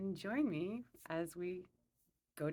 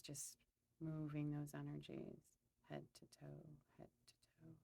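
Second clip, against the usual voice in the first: calm, unhurried meditation guide; guided meditation; 2 semitones lower, 6 dB quieter than usual. Acted. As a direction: lead them gently through a visualization, pausing between phrases.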